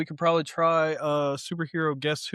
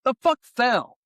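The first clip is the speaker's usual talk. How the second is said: startled; 8 semitones higher, 5 dB louder than usual. Real, not acted. Still startled by falling objects.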